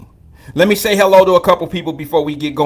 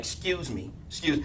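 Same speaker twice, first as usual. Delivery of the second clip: sedity excuse me; 3 semitones lower, 16 dB quieter than usual. Retold or imitated. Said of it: "no sedity ass attitude like".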